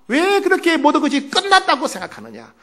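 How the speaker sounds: high-pitched reproach, no longer shouted; reproachful rebuke. Acted. Jesus reproves disciples who thought all was over, reproachfully. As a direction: press the question as a reprimand. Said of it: "예수님이 책망하셨어요".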